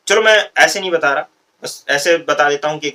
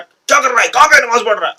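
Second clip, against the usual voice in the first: higher, louder angry rebuke from the mosque official; harsh scolding; 9 semitones higher, 6 dB louder than usual. Retold or imitated. Mosque official yelling at a first-time worshipper, harsh scolding. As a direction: bark at the newcomer as if he did wrong. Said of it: "इतनी जबरदस्त डांट".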